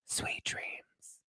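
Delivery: breathy whisper in her ear. Retold or imitated. Chris whispering goodnight into Holly's ear, a soft whisper. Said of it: "whispered into her ears".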